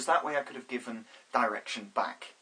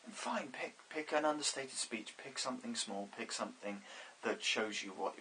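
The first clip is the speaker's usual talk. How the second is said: much softer, shrinking the voice to match; small and understated; 11 dB quieter than usual. Shown as a demonstration. Director advising actors, softly shrinking the voice down. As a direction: say it small and hushed.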